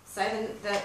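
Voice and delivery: normal talking voice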